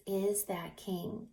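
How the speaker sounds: usual speaking voice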